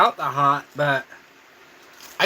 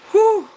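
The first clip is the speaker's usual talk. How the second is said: high, loud exhale of heat; loud whew; 7 dB louder than usual. Real, not acted. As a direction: blow out the burn with a big whew. Burning mouth from spicy food.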